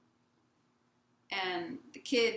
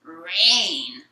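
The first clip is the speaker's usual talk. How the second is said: loud, drawn-out grumpy groan of a word; grumpy old man; 3 semitones lower, 14 dB louder than usual. Retold or imitated. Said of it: "what starts as a grumpy old man".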